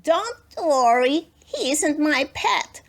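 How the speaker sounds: put-on a child calming her parents; little girl voice. A narrator's reading. Lulu voiced in story.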